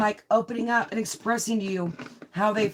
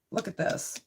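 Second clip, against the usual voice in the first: breathy whisper, much softer; hushed whisper over the cards; 6 dB quieter than usual. Real, not acted. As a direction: lean in and whisper it. Tarot card reveal.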